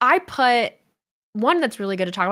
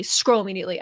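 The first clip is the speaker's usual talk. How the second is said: usual speaking voice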